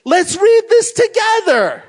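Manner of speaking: rally them to read along; loud urging. Retold or imitated. Pastor urging counselees to read aloud, loud and high. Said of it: "And then I say".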